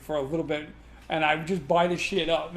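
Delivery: usual speaking voice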